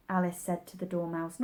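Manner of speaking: usual speaking voice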